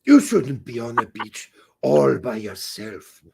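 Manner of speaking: put-on low, botched Jamaican accent; German-sounding Jamaican. Beach seducer warning the lone wife, German-tinged Jamaican accent. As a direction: attempt Jamaican and land somewhere near German. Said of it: "that's German Jamaican".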